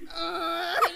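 high, loud mock sob; hammed-up wail of someone weeping; imitated weeping wail. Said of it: "weeping, like".

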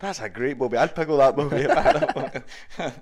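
loud, high put-on voice, laughing; Still Game character voice. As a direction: belt it out in a hammy character voice.

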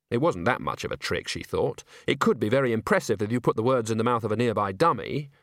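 plain reading voice

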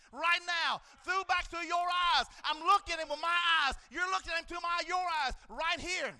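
loud, high preacher's shout; full-force sermon cry; preaching at full force. Acted. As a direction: shout it over the congregation.

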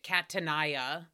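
Catania as cat-a-NAI-a, slow and low; misread pronunciation. Shown as a demonstration. Host repeating her reading, Catania slowly as cat-a-NAI-a. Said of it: "That's how I always see it".

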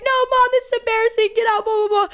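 high, whiny get out; mock teen protest. Teen telling mom to get out, high and whiny. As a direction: shoo mom out like an embarrassed teen.

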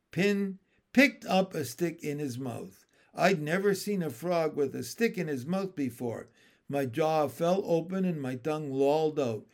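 usual speaking voice

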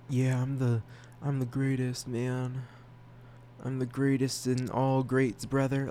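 lightly voiced boastful bro, a touch slow; mock cocky bragging. Mocking himself as a bragger, mild cocky swagger. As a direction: puff up like a show-off bro, half joking. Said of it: "come off like I'm full of myself".

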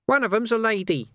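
high, sly, wheedling urchin voice; high-pitched cockney urchin hustling a copper; cheeky street urchin. Acted. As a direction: haggle like a grubby street kid. Urchin Nobby's voice.

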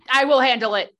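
loud, forceful snub; aggressive. Acted. Turning down Faye's help at a party, aggressive. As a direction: shut down the offer aggressively. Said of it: "in the most aggressive tone".

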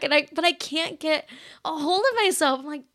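ordinary talk

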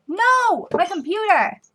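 sudden high alarmed yelp; startled high yelp about the computer; real alarm. Real, not acted. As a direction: cry out as the screen goes dark. Screen went off mid-recording.

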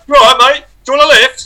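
high, cheery bloke voice; breezy offer from a stranger; friendly stranger. Retold or imitated. A stranger in the crowd, high and cheery. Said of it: "one guy just went".

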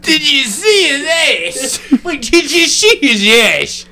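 high, slow squeaky voice; high silly voice. Retold or imitated. Repeating the funny voice.

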